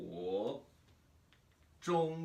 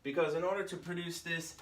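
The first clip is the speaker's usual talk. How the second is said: ordinary talk, nothing marked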